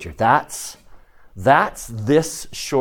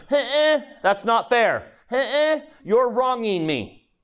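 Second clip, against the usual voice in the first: put-on high, whining kid complaining it's not fair; whiny child; 11 semitones higher, slower than usual. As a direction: play the sulky child who feels wronged. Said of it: "My children make it all the time".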